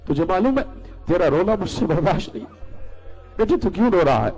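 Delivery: put-on high, pained plea to a crying child; pleading mother. Mother asking her crying son why, pleading.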